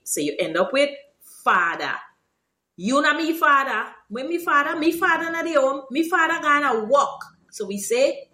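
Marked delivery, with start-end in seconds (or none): 2.8-7.2 high-pitched Guyanese Creolese protest; animated Guyanese Creolese denial; Creolese example. Shown as a demonstration.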